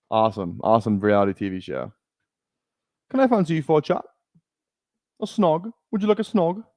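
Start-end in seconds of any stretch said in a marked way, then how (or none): 3.1-6.6 higher-pitched fake British; hammed-up British flirting; put-on UK accent. Streamer chatting someone up like a Love Islander, UK accent. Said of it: "my U K accent".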